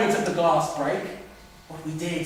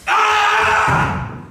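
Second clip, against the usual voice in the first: pained scream; 11 semitones higher, 4 dB louder than usual. Acted. Attacked during struggle.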